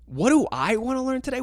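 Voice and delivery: high-pitched, incredulous echo of the question; incredulous inner reaction. Retold or imitated. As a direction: throw the question back in disbelief. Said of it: "in my head, I'm like".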